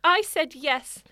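put-on dramatic reading of an old problem letter; reading the letter aloud. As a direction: read it out like a lovesick Victorian girl. S1 reads the letter, continued.

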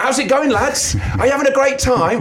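very high, chirpy imitation; mock-cheery friend. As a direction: chirp it like an oblivious cheerful mate. Mimics friend's cheery WhatsApp message.

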